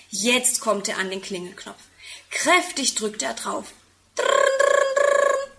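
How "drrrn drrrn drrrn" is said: high, loud rolled-r doorbell trill; doorbell imitation. A narrator's reading. Tim presses the doorbell button, imitated ringing. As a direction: trill your r like a ringing bell. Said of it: "Hört er es im Haus läuten".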